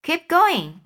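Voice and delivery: high, loud cheer; crying out. A narrator's reading. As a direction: urge him on loudly. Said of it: "they cried".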